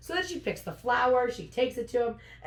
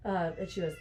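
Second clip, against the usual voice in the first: usual speaking voice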